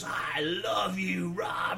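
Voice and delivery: put-on slow, slurred, high, half-cried drunk gush; drunk declaration. Drunk guitarist hollering love at his bandmate on the plane. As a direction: ham up a wasted bandmate professing love.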